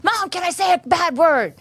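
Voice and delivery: put-on high pleading little girl voice; little girl asking. Daughter asking mom on the phone, in a high little girl voice. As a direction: ask sweetly like a small daughter. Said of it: "She's like".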